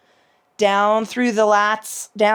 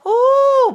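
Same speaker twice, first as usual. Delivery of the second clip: high ooh; 8 dB louder than usual. Real, not acted. Stretch sensation.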